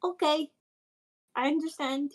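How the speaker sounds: high, soft, careful voice; soft in-character assent. Acted. Reassured friend in a safe place, soft high voice.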